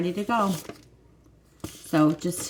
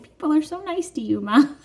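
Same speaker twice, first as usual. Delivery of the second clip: higher, lightly voiced young daughter; a hint of her sweet daughter; gently imitating her daughter; daughter's voice; 10 semitones higher, 4 dB louder than usual. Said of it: "and she's like".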